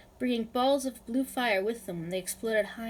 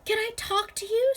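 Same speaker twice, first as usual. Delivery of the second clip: high, loud yell over a crowd; 13 semitones higher, 5 dB louder than usual. A narrator's reading. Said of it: "Sophie yelled".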